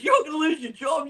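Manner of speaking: high mocking singsong; high, gleeful mock warning; taunting celebrities. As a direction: tease him in a high voice. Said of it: "He goes".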